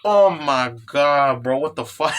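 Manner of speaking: retold shock. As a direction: re-enact a reader's dismayed outburst. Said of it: "I was literally going".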